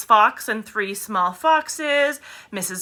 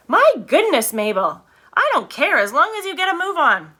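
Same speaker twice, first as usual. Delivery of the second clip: impatient Mrs Bean; 7 semitones higher, 4 dB louder than usual. A narrator's reading. Mrs Bean snaps from upstairs.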